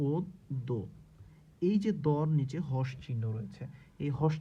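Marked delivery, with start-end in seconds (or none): 0.1-0.7 ও দ split as "od... do"; doubled consonant demo. Shown as a demonstration. Teacher breaks the word down, ও দ as "od", pause, "do". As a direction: close the first syllable on d, pause, then "do". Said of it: "দ দুইবার উচ্চারণ হবে".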